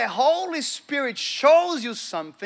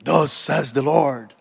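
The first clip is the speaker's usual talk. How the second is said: low, slow mock voice of God; booming divine pronouncement; 8 semitones lower, slower than usual. Preacher mimicking how people imagine God speaks, deep solemn voice.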